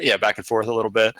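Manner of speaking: ordinary talk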